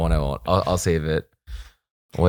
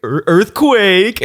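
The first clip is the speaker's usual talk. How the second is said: higher, slow, drawn-out playful warning; mock earthquake call; 6 semitones higher, much slower than usual. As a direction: stretch out earthquake like a goofy crane operator. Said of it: "Sean would be like".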